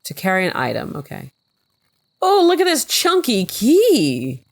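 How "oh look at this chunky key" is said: high, slow, thrilled gush; delighted. Real, not acted. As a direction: squeal over something cute. Delighted by a game object.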